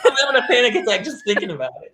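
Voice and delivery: high-pitched, laughing panic; laughing dismay. Real, not acted. Listener reacting to child put on stage first, laughing mock panic.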